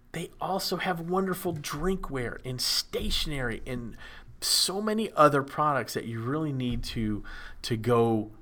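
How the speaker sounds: upbeat ad read with a showman's pitch; kids party magician. Acted. Ad read for Gold Star.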